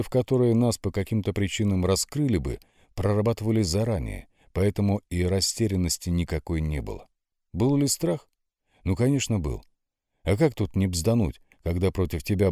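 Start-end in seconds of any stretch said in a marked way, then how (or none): none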